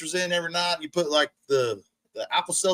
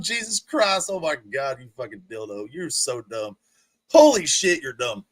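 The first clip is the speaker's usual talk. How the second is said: ordinary talk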